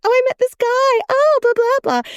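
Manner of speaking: high-pitched mock girly gushing; gushing girlfriend chat. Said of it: "with my girlfriends home".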